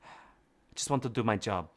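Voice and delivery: soft, low-pitched quiet reply; quietly. Acted. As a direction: say it low and withdrawn, barely projecting. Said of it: "she says quietly".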